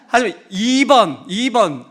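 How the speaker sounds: normal talking voice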